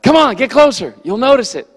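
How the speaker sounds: loud, high coaxing call. Retold or imitated. Pastor urging his wife and girls to ride closer, calling loudly. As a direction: shout encouragement over your shoulder. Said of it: "I was like".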